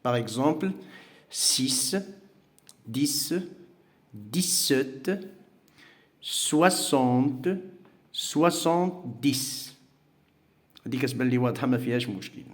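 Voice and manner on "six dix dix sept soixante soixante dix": slow model word. Shown as a demonstration. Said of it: "ça se prononce S".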